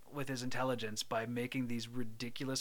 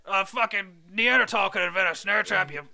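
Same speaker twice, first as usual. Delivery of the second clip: loud, raised-pitch tirade; hammed-up furious father; berating dad; 4 semitones higher, 6 dB louder than usual. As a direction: tear into the useless son. Acted dad berating his son.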